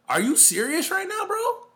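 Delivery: can't believe what he's hearing; incredulous. Real, not acted. Podcaster reacting to Paul George's cop-out, incredulous. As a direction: pitch shooting way up in disbelief.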